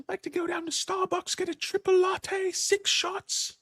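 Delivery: very high squeaky cartoon dog voice; squeaky hyper pup, pitched very high; Felix as a dog. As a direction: yap it out like a wired little dog. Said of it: "You should get Felix on here as dog".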